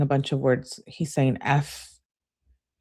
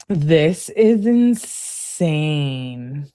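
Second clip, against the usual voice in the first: very slow, drawn-out disbelief; stunned disbelief; much slower than usual. Real, not acted. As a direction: stretch each word out, truly stunned. Reacting in shock to the messages.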